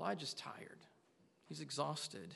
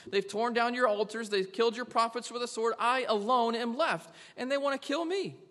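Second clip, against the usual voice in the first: complaining Elijah; 5 semitones higher, 7 dB louder than usual. Retold or imitated. Elijah's complaint grows louder.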